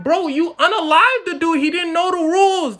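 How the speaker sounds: high, loud comic complaint; mock protest. British protesting the killing, high and loud.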